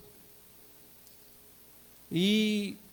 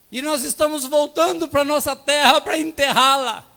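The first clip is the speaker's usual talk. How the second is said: fervent preaching; 6 semitones higher, 6 dB louder than usual. Real, not acted. Preaching: pastor tells the church the father's answer, fervently. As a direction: let the reveal land with full force.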